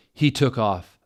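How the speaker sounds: normal talking voice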